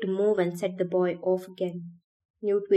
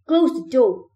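higher, louder shouted order; raised-voice narrated shout; 5 semitones higher, 9 dB louder than usual. A narrator's reading. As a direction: call it out like a parting insult. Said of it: "Albie shouted one final insult".

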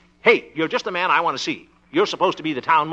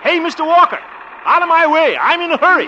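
loud, high yell up the street; calling out; 12 semitones higher, 6 dB louder than usual. Acted. Calling to tractor driver ahead.

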